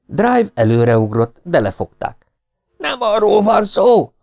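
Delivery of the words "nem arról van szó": detective held back by fans, bellowing at them; shouting at the top of lungs. A narrator's reading. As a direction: yell it with everything you have. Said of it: "üvöltött torka szakadtából".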